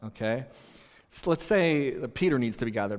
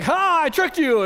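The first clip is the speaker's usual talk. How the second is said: loud, high triumphant crowing; gloating taunt; 14 semitones higher, 9 dB louder than usual. Retold or imitated. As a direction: shout it to rub their noses in it. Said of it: "I did it like that in the game".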